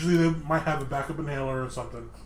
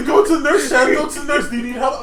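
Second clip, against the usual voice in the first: higher, louder imitated insistence; mock urgent urging; 8 semitones higher, 7 dB louder than usual. Friend urges asthma sufferer to the nurse, mock urgent. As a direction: press him hard, half-joking.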